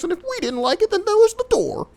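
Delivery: high, squeaky mock boss voice; mocking impression of a bossy director. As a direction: mimic the boss in a silly high voice. Mimicking the director's ultimatum.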